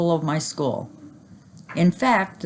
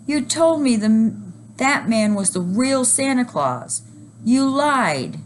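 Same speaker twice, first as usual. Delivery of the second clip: upset scream; 8 semitones higher, slower than usual. A narrator's reading. Girl accusing her mother of lying, high wail. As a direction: cry it out at mom. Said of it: "screamed another girl".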